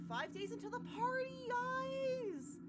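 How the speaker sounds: high, loud excited squeal; thrilled, drawn-up high cry to the crowd; excited party announcement. Real, not acted. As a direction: cheer the party news in a high, ringing voice. Reads game's party countdown with glee.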